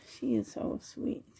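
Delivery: soft low whisper. Real, not acted. Cooing over doll.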